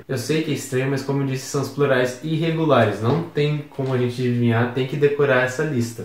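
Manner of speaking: ordinary delivery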